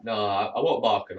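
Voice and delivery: normal talking voice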